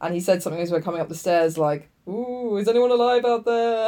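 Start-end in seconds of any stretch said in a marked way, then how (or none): 2.1-3.9 mock spooky voice. Retold spooky museum quip.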